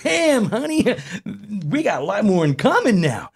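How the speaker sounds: high, loud mock amazement; hammed-up impressed boyfriend; retold delighted reaction. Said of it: "I was like".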